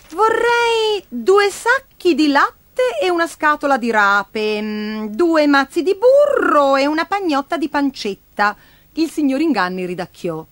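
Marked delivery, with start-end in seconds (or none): 0.1-8.6 put-on high child voice, drawing out the first words; high, halting boyish order; little boy, hesitantly ordering. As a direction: read off a shopping list as an unsure small boy.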